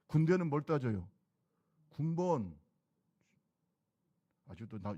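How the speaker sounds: ordinary talk, nothing marked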